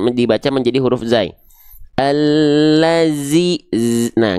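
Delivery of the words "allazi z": ALLAZI slowly, with a buzzing Z; whistle-buzz the z in "Allazi", then isolate it; zai replacing zal. Shown as a demonstration. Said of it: "nggak boleh ada sofirnya". ("Allazi", zal dibaca zai dengan sofir)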